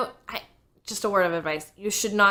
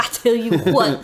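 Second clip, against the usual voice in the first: mock Southern accent; 2 semitones higher, 5 dB louder than usual. Echoing in a Southern voice.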